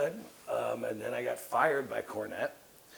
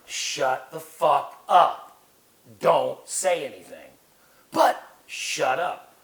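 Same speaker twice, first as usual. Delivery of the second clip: retold angry order; 3 semitones higher, 10 dB louder, slower than usual. Retold runner telling him off.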